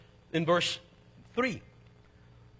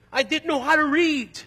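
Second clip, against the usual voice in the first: frustrated cry; 8 semitones higher, 5 dB louder than usual. Retold or imitated. Retelling his frustration, pitch raised.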